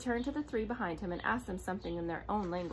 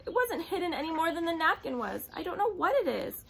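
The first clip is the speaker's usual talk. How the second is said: tearful denial; 8 semitones higher, 5 dB louder than usual. A narrator's reading.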